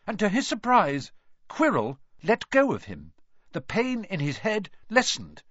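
ordinary delivery

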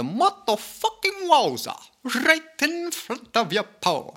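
high, playful Scottish lilt; Scottish accent. Pretend. Podcaster's Scotsman slips out while riffing to listeners, high Scottish lilt. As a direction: slip into a cheeky Scottish voice. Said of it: "you got my Scotsman coming out there".

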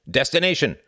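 sharp barked question. A narrator's reading. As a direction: bark it like an official. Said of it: "the short, squat official had barked".

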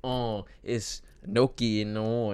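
slow drawn-out mock Italian accent on gnocchi. Coworker mimicking her Italian boss's accent. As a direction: imitate an Italian saying gnocchi slowly. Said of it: "talking about that shit in an accent".

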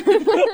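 squeaky high laugh. Real, not acted. Laughing at joke.

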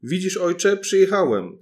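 put-on a tiny boy calls to his father, loud and proud; calling out proudly. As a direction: shout to father in triumph. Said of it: "zawołał".

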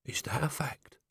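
hushed barman, whispering. A narrator's reading. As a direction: keep the secret, breathe it. Barman whispers back, confidential.

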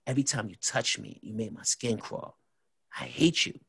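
soft, retold menace; hushed, deliberate confrontation; scary-serious. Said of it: "Why are you talking like that".

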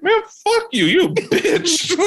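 high, giggling cuss-out; laughing insult. Retold or imitated. Kid cursing the driver while laughing. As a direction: laugh through the insult like a brat.

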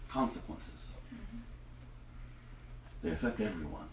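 soft devotional. Acted. Quiet devotional reflection.

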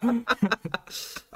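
high, loud laughing admission; sheepish laughing giggle. Real, not acted. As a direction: laugh it off, caught out. Laughing at himself, caught out.

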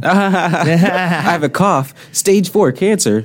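high-pitched, drawn-out laughing self-diagnosis; slow, high mock alarm, cracking up; mock panic through laughter. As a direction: laugh through a hammed-up worst-case verdict.

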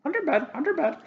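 tiny high shrill chant; squeaky creature voice. Acted. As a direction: squeak it like a little talking trinket. Item speaks warning.

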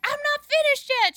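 very high, loud mock protest; homeless man protesting. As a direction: holler indignantly as the interrupted man. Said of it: "he's like".

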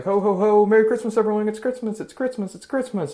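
loud, high jolly Santa voice; jovial Santa. Retold or imitated. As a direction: boom out a cheery ho ho ho. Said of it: "and it's like".

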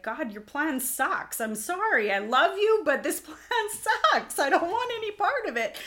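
a raw, overwrought cry of protest with a laugh breaking in; despairing prayer. Retold or imitated. Mother on the bathroom floor crying out to God, loud and high with a laugh. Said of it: "sobbing on our bathroom floors".

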